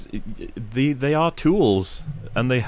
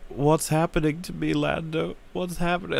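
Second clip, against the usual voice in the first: higher, plaintive mock confusion; lightly acted helpless question, pitched up; dying droid impression; 6 semitones higher, 4 dB quieter than usual. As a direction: imitate a fading character pleading for answers. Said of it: "She says something like".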